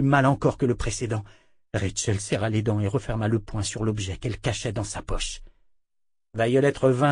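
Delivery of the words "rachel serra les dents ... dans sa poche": ordinary delivery, nothing marked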